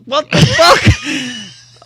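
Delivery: retold shock, loud and high; shocked outburst. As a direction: burst out in loud, high-pitched disbelief. Reacting to brother's coming-out text.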